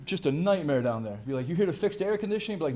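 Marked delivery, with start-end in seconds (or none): 1.5-2.6 much higher, quick sarcastic question; hammed-up mock inmate jab. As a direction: needle the visitor with fake innocence. Inmates mocking the NA visitors.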